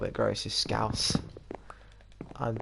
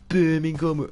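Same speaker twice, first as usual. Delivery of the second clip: higher, hammed-up Birmingham accent; terrible Brummie accent; 6 semitones higher, 6 dB louder than usual. Comparing posh with someone from Birmingham, terrible Brummie accent. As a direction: put on a bad Brummie voice. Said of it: "that's a really terrible accent".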